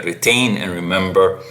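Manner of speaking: ordinary talk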